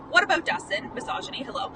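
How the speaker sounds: usual speaking voice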